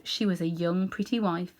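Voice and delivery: ordinary delivery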